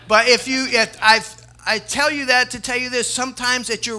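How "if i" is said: normal talking voice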